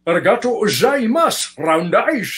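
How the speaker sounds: Connery's mock Japanese accent; Japanese accent via Connery. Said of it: "teach you ... Japanese".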